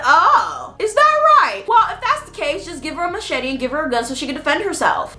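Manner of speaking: high, mocking "is that right"; sarcastic sting, then fast advice. Sarcastic reply to the family's complaint. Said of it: "And I said".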